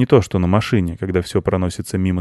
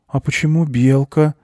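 put-on high, puzzled little boy; surprised boy; 7 semitones higher than usual. A narrator's reading. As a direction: ask in a child's higher voice, surprised. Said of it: "удивился мальчик".

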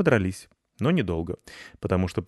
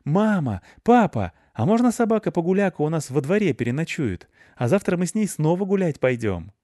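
put-on high-pitched, louder pleading child; excited boy calling; 10 semitones higher, 5 dB louder than usual. A narrator's reading. Boy begging parents to let the dog stay, excited. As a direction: call out as an eager little boy. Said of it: "то сразу закричал".

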